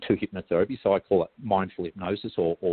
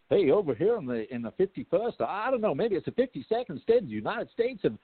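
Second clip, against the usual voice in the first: mock American voice; 10 semitones higher than usual. Teasing his American friends, a high hammed-up American voice. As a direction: play up a cheesy American greeting. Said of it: "And, and I say".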